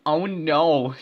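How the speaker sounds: loud alarmed "oh no"; exclaimed. Acted. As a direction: shout it in alarm. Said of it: "Tom exclaimed".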